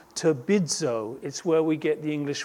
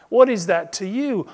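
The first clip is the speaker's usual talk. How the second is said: loud, raised pitch with a punch on "you"; emphatic challenge; 5 semitones higher, 7 dB louder than usual. Shown as a demonstration. Preacher repeating Jesus's challenge to Peter, emphatic. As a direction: drive "What" and "you" home. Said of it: "I love that emphasis".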